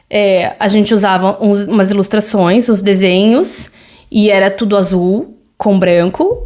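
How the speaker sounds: usual speaking voice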